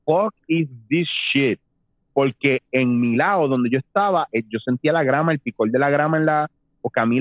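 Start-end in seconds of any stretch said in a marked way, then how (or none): 0.1-1.6 slow, stunned retold reaction; stunned disbelief. Telling friends what he said at the strange vision, stunned. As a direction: retell it dumbfounded, stretching out each word. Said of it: "yo me quedé loco".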